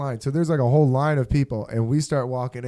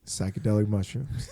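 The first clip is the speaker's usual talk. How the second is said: dead serious; 5 semitones lower, 6 dB quieter than usual. Retold or imitated. Dead-serious confession to Amanda.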